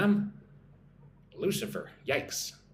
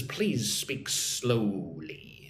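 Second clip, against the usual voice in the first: playfully drawn-out, very slow words; exaggeratedly slow; 4 semitones lower, much slower than usual. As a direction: stretch every word right out, lower at the end. Acts out request to speak slowly.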